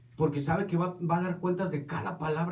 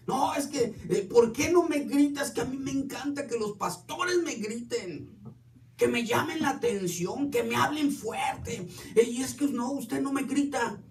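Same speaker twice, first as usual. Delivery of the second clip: higher mock voice of a pleading church lady; imitating a lady; 6 semitones higher than usual. Pastor imitates a congregant begging to be scolded, higher mock voice. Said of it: "Era una señora".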